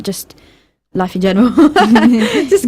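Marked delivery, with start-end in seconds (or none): 1.5-2.4 loud, higher-pitched laugh; real laugh. Real, not acted. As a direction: burst out laughing, loud and high. Laughs after awkward remark on life.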